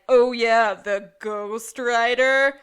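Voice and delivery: loud, slow, scornful outburst; a loud retold confrontation; mock loud accusation. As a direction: bellow it dismissively. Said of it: "Gus is like".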